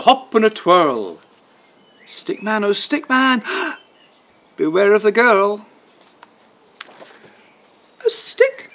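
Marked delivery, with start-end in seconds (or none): 2.1-5.7 high sing-song warning chant; warning refrain. A narrator's reading. Storyteller chanting a warning to Stickman, sing-song. 8.1-8.7 very high delighted cry; girl cries out. Acted. Girl spotting a stick, high excited cry. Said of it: "cries the girl".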